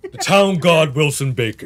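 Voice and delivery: loud, grand title announcement; booming title; grand proclamation. Pretend. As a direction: herald the god Wilson Baker. Repeating the boast grandly.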